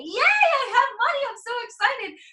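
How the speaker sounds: high, loud, gleeful squeal; acted-out burst of excitement; excited cheer. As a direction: celebrate like you just won money. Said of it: "instead I get actual excited".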